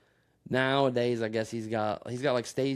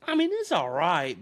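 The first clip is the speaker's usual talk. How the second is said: slow, drawn-out, higher mock voice; mild impression of an unimpressed eater; imitating the meme guy; 6 semitones higher, 5 dB louder than usual. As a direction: lazily hand down a verdict on the sandwich. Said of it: "that one meme where he has the sandwich, he's like".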